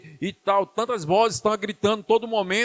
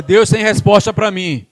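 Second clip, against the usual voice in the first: loud, slow, higher declaration; rallying declaration; 4 semitones higher, 10 dB louder than usual. Shown as a demonstration. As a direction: proclaim it for the crowd to echo. Has congregation repeat a declaration.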